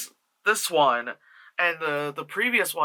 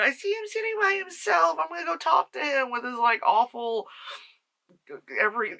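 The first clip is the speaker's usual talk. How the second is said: much higher mock British girl; British accent; 9 semitones higher than usual. Reviewer still mocking Shelley in a British accent. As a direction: keep up the hammed-up British voice. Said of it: "she's British".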